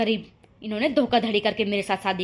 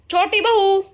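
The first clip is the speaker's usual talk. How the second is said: much higher, louder second call of छोटी बहू; 8 semitones higher, 6 dB louder, slower than usual. Acted. Calls again, more forcefully.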